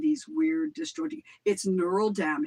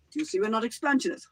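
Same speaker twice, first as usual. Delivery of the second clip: fast clipped quote; imitating Putin at a news conference; quoting Putin; 3 semitones higher, much faster than usual. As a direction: rattle it off quickly. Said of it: "I analyzed Vladimir Putin's language".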